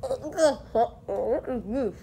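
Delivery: laughing through a full mouth; garbled words round marshmallows; cheeks stuffed full. Real, not acted.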